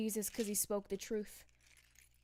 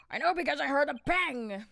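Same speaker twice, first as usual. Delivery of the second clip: loud; 6 semitones higher, 8 dB louder than usual. Acted. Character line read aloud.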